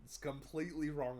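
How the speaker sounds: ordinary talk, nothing marked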